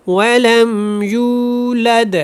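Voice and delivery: وَلَمْ يُولَدْ with و fully sounded, slow; full و. Shown as a demonstration. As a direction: pronounce every letter. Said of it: "پورا ادا کریں". (واو پوری ادا)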